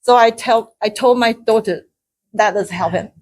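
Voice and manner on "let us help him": ordinary talk, nothing marked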